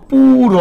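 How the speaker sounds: পুর called out high and loud, clear as a model; syllable said loud. Shown as a demonstration. Teacher models পুর loudly for online students. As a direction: sound out পুর loudly for the class. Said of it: "সবাই একটু উচ্চারণ করেন তো".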